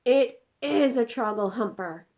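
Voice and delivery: loud, high exasperated insistence. Acted. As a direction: stress that it IS. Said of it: "cried the exasperated B F G".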